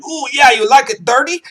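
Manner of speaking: high-pitched, saucy catcall; hammed-up dirty-talk voice; sleazy call sign. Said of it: "when I give the call sign".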